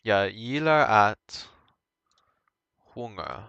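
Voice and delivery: "jag gillar att sjunga" spoken slowly in Swedish; Swedish sentence. Shown as a demonstration.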